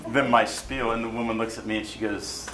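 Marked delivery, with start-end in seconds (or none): none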